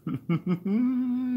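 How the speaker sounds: high cartoon dog giggle; Scooby laugh. Streamer reprising the Scooby-Doo laugh, high and cartoonish.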